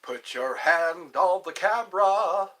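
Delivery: loud, slow faith-healer command; Benny Hinn. Acted. Faith healer calling to viewers, loud imitation. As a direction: draw out each word like a healing preacher. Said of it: "Benny Hinn".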